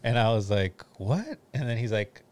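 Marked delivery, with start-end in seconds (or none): 1.0-1.4 shocked. Retold or imitated.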